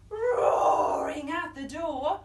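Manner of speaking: slow, loud, roaring read; roaring delivery. Acted. Storyteller roars the lion's arrival to the kids. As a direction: roar the line out slowly.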